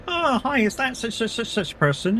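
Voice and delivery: high, sing-song cold-caller greeting; mock telemarketer. Telemarketer ringing a stranger to check the name, mock chirpy voice. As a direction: imitate a telemarketer opening a call, pitched well up.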